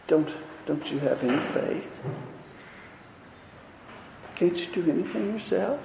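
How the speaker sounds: apologetic soft voice, afraid to offend; meek and timid. Retold or imitated. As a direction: ask it timidly, almost under your breath. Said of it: "Do you think he said that?".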